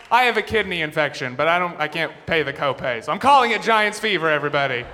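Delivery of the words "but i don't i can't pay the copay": ordinary delivery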